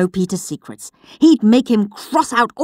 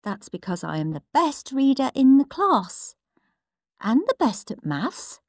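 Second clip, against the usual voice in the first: prim little brother; 6 semitones higher than usual. Acted. Peter's diary entries read out as a prim, smug little boy. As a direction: read it like a proud little swot.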